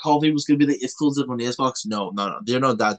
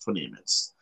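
quiet repeat; 11 semitones lower, 9 dB quieter than usual. Real, not acted. Repeats the countdown lower.

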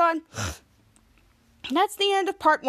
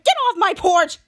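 loud, high-pitched outburst; retold angry shout; 5 semitones higher, 6 dB louder than usual. Henry to Jasper interrupting again, angry shout. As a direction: shout the intruder away. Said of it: "Henry shouted".

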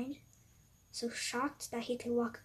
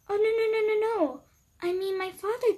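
much higher and louder, fast no's; panicked backpedal; 11 semitones higher, 11 dB louder, much faster than usual. Acted. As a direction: scramble to take it back. Cupcake backpedals after offending John.

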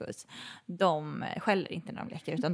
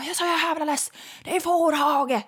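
high, loud mock yell; exaggerated fed-up bellow; shouting angry neighbour; 9 semitones higher, 5 dB louder than usual. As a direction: holler it from a balcony in outrage. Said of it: "och skriker".

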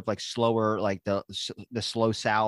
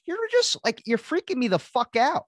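exasperated high-pitched complaint; 11 semitones higher than usual. Acted. Caller-pestered host telling the vaccine line off, exasperated and high-pitched. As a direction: voice jumps way up, freaked out. Mock rant about vaccine hotline calls.

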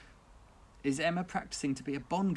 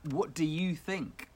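ordinary delivery

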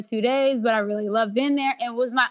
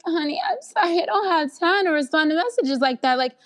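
sassy brush-off; 7 semitones higher than usual. Retold or imitated. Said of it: "I'm like".

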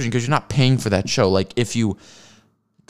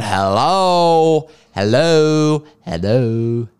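louder mocking calls, fading; sarcastic hello; 3 semitones higher, 4 dB louder, much slower than usual. Real, not acted. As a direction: knock on their skull with words. Mock calling to dense viewer.

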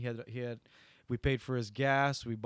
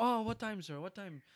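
higher-pitched, lightly imitated eagerness; eager asking; 8 semitones higher, 3 dB quieter than usual. Driver offered sixty dollars for tomorrow, imitated eagerness. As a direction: jump at the offer, pitch raised.

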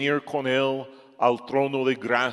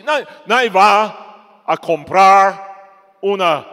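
ordinary delivery

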